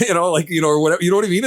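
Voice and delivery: high, giggly talk through a laugh. Real, not acted. To podcast co-hosts after joking quote, laughing.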